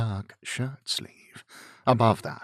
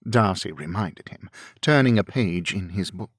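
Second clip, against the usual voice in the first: ordinary delivery, nothing marked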